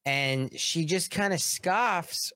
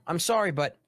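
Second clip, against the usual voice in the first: ordinary delivery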